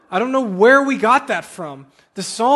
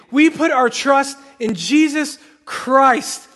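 fervent declaration; 4 semitones higher, 3 dB louder than usual. Acted. Church declares its trust before God.

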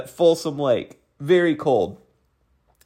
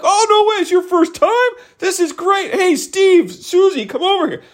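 very high-pitched delighted disbelief; hammed-up, high excited surprise; amazed enthusiasm. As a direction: imitate a thrilled stranger finding out. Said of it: "lose their enthusiasm for me".

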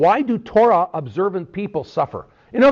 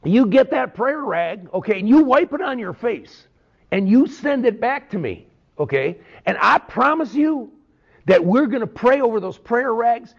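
put-on TV preacher accent; 3 semitones higher than usual. Pastor mocking a TV evangelist selling prayer rags, hammed-up evangelist accent. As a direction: lay on the televangelist accent, selling hard. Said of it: "they all talk in that type of accent".

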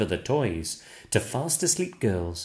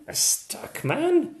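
much higher, amazed question; surprised question; slower than usual. A narrator's reading. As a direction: squeak it in surprise.

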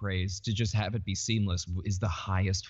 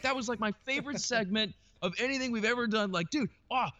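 high, gushing fanboy imitation; Kevin Smith; 12 semitones higher than usual. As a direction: imitate an awestruck fan raving in a raised pitch.

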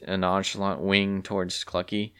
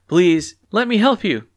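eager high offer; 10 semitones higher, 9 dB louder than usual. A narrator's reading. Clucky eager help.

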